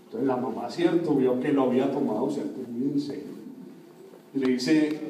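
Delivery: ordinary talk, nothing marked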